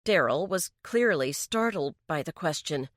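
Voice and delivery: usual speaking voice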